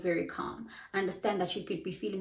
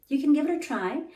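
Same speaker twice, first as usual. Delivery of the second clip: high, louder and upbeat; encouraging; 7 semitones higher, 6 dB louder than usual. Retold or imitated. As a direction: cheer the student on to try. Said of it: "I'll say".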